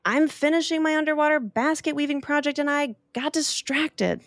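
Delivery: a distracted manatee. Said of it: "The manatee said".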